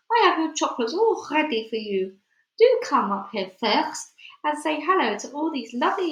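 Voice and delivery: lightly voiced sugary witch luring a child; syrupy witch. A narrator's reading. Witch lightly sweet-talks Bruno up to the platform. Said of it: "soft and gentle and absolutely dripping with syrup".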